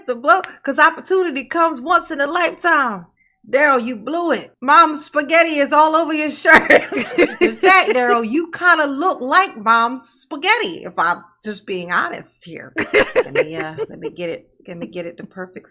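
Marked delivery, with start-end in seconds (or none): 0.1-10.8 loud, laughing, gleeful teasing; excited roast. Real, not acted. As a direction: cackle through the roast.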